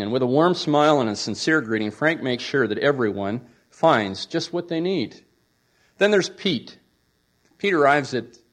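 normal talking voice

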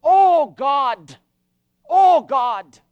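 loud, drawn-out cry to God; agonized, urgent entreaty; pleading prayer. Acted. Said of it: "there was an "oh" in his prayer".

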